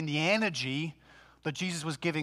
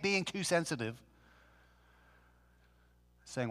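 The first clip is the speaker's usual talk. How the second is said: usual speaking voice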